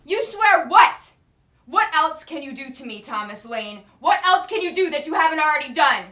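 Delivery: high, loud and defiant; furious shout. Acted. Wife defies her husband, furious high shout. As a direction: throw it back in his face.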